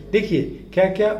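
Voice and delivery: usual speaking voice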